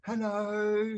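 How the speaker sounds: high, dopey innocent greeting; the other driver answering like nothing happened; clueless driver. Retold or imitated. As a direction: play it totally oblivious. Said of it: "And they're like".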